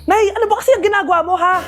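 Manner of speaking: rapid, exasperated nagging at mom; child pestering busy mother, fast and exasperated. Acted. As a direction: rush it out like an impatient kid.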